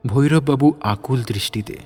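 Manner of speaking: normal talking voice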